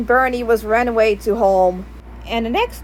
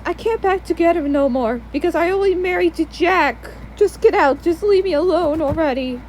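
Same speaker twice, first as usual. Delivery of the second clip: higher, insistent mom voice; pleading refusal; 4 semitones higher than usual. Acted. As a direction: refuse him in a raised-pitch voice. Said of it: "And my mom says".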